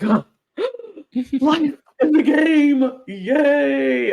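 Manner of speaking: high gasp, giddy squeal; mock excitement. Imitating the overexcited player, high giddy gasp. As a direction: gasp like a kid with a new toy. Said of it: "He was just so excited".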